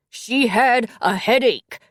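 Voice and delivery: slow, loud desperate yell. Acted. Boyfriend yelling at the dispatcher about the headache, loud. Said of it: "he yelled".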